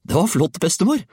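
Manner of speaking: put-on high, delighted boy's cheer; excited call. A narrator's reading. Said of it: "ropte Georg".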